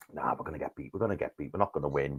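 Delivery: low, quiet resigned muttering; gloomy inner voice. Retold or imitated. Fan before the game, quietly resigned. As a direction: sigh it like you already know. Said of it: "you think".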